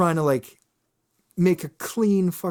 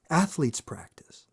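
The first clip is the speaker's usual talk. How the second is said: whispered aside; 6 dB quieter than usual. Real, not acted. Punchline aside.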